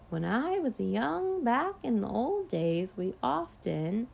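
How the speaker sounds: put-on slow, high, wavering old man's voice; quavering old grandpa. A narrator's reading. Boy imitating grandpa dozing off mid-story, quavering. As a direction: quaver through a rambling tale, very slowly. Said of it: "in a quavering voice".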